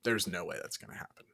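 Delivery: low, quick, half-whispered dismissal; muttered aside. Retold or imitated. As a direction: mutter it under your breath. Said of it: "I would have been like".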